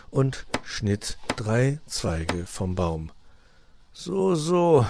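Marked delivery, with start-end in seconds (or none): 3.9-4.9 drawn, higher-pitched murmur of mild displeasure; grumbling. A narrator's reading. Uncle fox digests his nephew's remark, grumbling. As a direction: grumble it sceptically. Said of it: "murrte Herr Fuchs".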